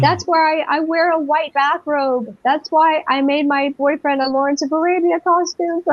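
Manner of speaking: high-pitched, gushing reenacted realization; retold crying. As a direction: act out tearful babbling, voice held high. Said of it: "I started crying and I was saying".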